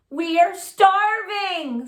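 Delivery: slow, drawn-out hungry complaint; hungry friends. Acted. Hungry friends complaining to Clark, slow drawn-out whine. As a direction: stretch it out slowly like starving. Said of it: "said his friends".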